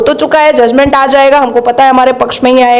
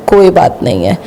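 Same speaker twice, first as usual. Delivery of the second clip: sarcastic dismissal; 7 semitones lower than usual. Retold or imitated. Sarcastic refrain after each charge.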